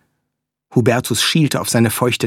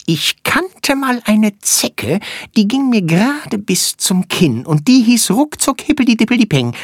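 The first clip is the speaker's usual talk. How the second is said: high, quick little flea voice; squeaky tiny creature; 9 semitones higher than usual. Acted. Flea character speaks.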